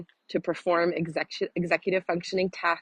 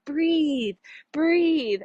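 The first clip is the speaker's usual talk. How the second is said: a much higher, sing-song "breathe, breathe"; imitating her breathe reminder; 10 semitones higher than usual. Coach mimicking herself reminding her girls to breathe, in a high sing-song voice. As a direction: play your own nagging coach prompt, lifted way up. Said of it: "'cause I'm always like".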